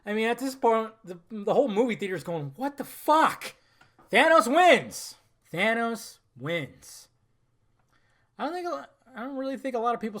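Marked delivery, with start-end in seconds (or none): 2.5-3.5 high, loud, outraged disbelief; imitated audience shock. Imitates whole theater's shocked reaction. 4.1-5.1 high, loud, slow cry of disbelief; shocked exclamation. Retold or imitated. Shocked exclamation, then repeated low. 5.5-7.1 very slow, at normal pitch and volume; slow, sober repeat. Real, not acted. Same words repeated in usual pitch.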